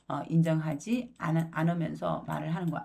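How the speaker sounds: ordinary delivery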